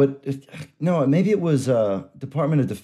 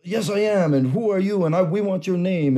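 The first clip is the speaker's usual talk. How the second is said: high-pitched imitation of the scammer; insistent phony agent; 7 semitones higher than usual. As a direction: push back as an indignant fake official. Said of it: "And he's like".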